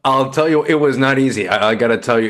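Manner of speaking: loud and high, bursting out; excited burst. Real, not acted. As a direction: jump in with loud enthusiasm. Guest laughs, then bursts out.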